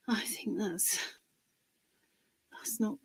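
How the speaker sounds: whispered aside to herself; soft unvoiced whisper. Real, not acted. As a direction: breathe it under your breath. Muttering while adjusting camera.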